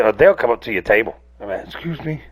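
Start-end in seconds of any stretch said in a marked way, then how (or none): 1.6-2.2 timid beggar. Retold or imitated. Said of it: "He'll come up to you".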